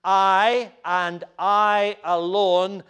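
slow emphatic declaration. Shown as a demonstration. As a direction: lean hard on I and I alone. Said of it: "that's an emphatic statement".

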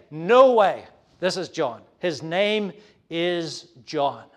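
loud NO WAY, then measured "His name is John"; firm refusal. Acted. Elizabeth settling the baby's name, firm refusal. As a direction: declare it with a pause before "is John". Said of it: "Elizabeth is saying".

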